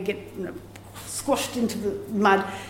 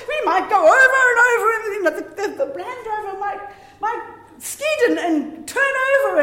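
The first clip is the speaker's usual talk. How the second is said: laughing plea; 9 semitones higher, 3 dB louder than usual. Retold or imitated. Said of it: "so he said".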